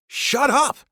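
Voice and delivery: high loud snap; father snapping at a drunk to stop shouting; snapped. A narrator's reading. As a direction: bite off a loud snapped order. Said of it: "I snapped as loudly as I dared".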